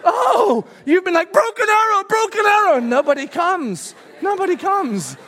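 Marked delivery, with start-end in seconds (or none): 0.0-2.8 high, loud laughing sympathy; laughing realization. Retold or imitated. Husband to wife, realizing her days: laughing. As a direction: laugh as it dawns on you. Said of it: "I'm like".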